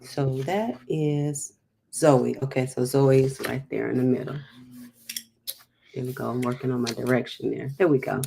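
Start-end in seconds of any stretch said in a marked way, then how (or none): none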